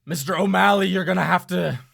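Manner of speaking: stern villain voice. Acted. Villain warns the cowering man to surrender, stern. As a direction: lean in and threaten him, firm and steady. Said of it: "and he'll say".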